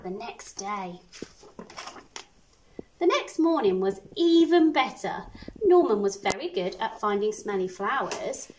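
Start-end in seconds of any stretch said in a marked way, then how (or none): none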